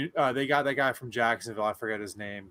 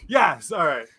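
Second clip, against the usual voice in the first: laughing; 11 semitones higher, 10 dB louder than usual. Real, not acted. Still laughing after impression.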